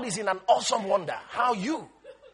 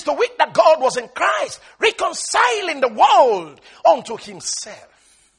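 loud, high preacher's reading; ringing, emphatic Bible reading; declaimed scripture; 7 semitones higher, 11 dB louder, slower than usual. Acted. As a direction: proclaim the verse to the whole church. Declaims the verse.

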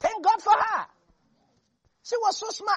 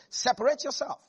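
ordinary talk, nothing marked

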